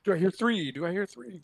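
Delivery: fast, high auction caller chant; auctioneer. Pretend. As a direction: call the bids like an auctioneer. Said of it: "break into an auctioneer's voice".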